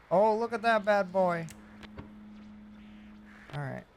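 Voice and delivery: ordinary delivery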